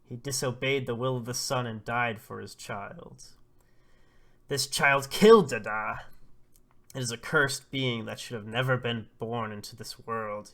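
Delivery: loud, dramatic condemning speech; crazed denunciation. Acted. Old man Oba blames the child for Dada's death, crazed loud denunciation. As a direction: denounce the child forcefully, surging on the accusation. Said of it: "He is going crazy".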